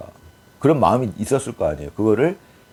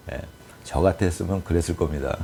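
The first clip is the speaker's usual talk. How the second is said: ordinary talk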